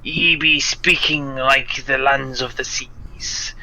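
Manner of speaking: slow, hushed pirate accent; pirate speak. Shown as a demonstration. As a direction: breathe it like an old sea dog. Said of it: "the pirate speak".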